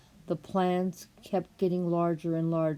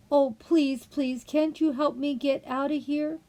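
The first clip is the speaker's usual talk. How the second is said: put-on higher, louder girl's plea, begging; 8 semitones higher, 4 dB louder than usual. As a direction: beg him to help you get out, voice up high. Alice begs the rabbit.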